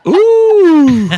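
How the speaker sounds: high, loud mocking ooh; loud teasing ooh. Real, not acted. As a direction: tease them with a big ooh. Reaction to teasing about flying.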